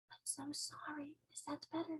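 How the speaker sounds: very soft, careful whisper; hushed, apologetic question; whispered apology. Acted. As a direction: whisper so as not to hurt their ears. Sophie whispers to sensitive ear.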